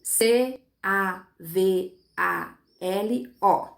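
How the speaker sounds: spelled out very slowly, a pause after each letter; evenly spaced letter names. Shown as a demonstration. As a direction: dictate the spelling one letter at a time. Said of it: "separei primeiro nas menores partes".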